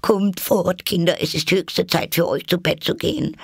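very high canary voice; trembling chirp. A narrator's reading. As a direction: pipe anxiously to your little ones. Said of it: "ein Kanarienvogel piepte zitternd".

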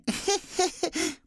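high dwarf giggle. Acted. Dwarf laughs.

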